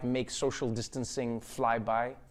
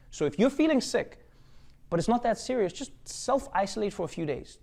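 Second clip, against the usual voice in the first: much higher, sing-song public service voice; much higher mock announcer giving the sick advice; 6 semitones higher than usual. Shown as a demonstration. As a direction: parody a health announcement, pitched way up. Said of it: "This is not from me, by the way".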